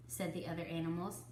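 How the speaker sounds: plain reading voice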